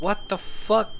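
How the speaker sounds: high, loud shocked yell; real startled outburst. Real, not acted. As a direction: blurt it out in sudden shock. Jump scare reaction.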